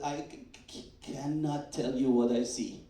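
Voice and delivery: mock stunned stutter; imitating stammer. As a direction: stammer out the words, speechless. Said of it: "he was speechless".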